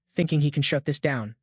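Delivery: ordinary delivery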